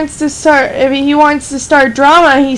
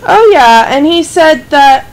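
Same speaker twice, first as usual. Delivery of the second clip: normal talking voice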